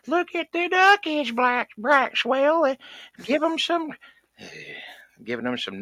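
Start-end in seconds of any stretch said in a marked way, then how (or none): 0.1-3.9 put-on much higher, cooing baby talk to a toddler; high sing-song mom voice; mocking the hipster mom. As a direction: gush over the duckies like a doting mom. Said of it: "the mama kept saying".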